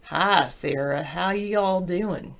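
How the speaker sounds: slow drawn-out Southern greeting; Southern drawl. Pretend. Greeting the chat as Southern Sarah, slow drawl. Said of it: "Southern Sarah".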